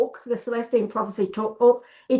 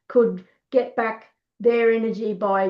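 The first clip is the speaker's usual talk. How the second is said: usual speaking voice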